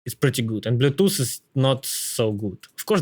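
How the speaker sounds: normal talking voice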